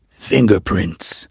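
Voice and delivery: slow, drawn-out smugness. A narrator's reading. Batman correcting Gordon's ballistics guess, slow and smug. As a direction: savour the word smugly and slowly. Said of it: "Batman replied even more smugly".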